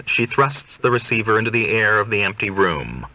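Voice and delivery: plain reading voice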